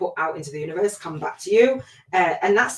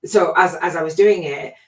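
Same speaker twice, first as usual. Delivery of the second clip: ordinary talk, nothing marked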